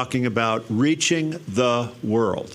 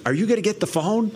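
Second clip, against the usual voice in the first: imitating wife; 9 semitones higher, faster than usual. Wife voiced in phone story.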